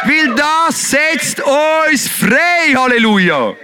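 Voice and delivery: slow, high shouted praise; shouting. Shown as a demonstration. Preacher shouting praise to the congregation. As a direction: draw it out and shout. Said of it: "Brauch deine Stimme".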